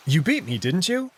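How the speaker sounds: normal talking voice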